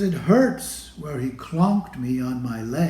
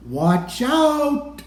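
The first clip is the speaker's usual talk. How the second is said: warning cry; 10 semitones higher, 4 dB louder than usual. Retold or imitated. Warning shout at the music shack.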